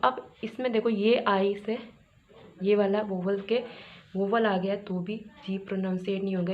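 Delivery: usual speaking voice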